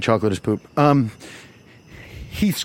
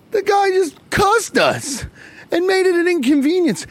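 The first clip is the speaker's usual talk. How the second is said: outraged high rant; 14 semitones higher than usual. Real, not acted.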